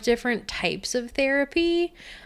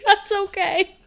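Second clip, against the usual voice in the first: ordinary delivery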